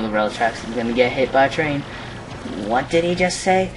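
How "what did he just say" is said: high-pitched mock outrage; indignant henchwoman. Henchwoman calls back the fleeing cheapskate, high indignant voice. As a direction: scold in a raised character voice.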